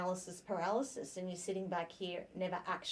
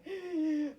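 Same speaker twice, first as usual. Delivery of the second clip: anxious gasp; 9 semitones higher, 5 dB louder than usual. Retold or imitated. Imagining future worries.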